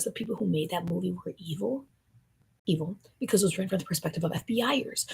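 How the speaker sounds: fast conspiratorial whisper. Real, not acted. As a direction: hiss the conspiracy quickly.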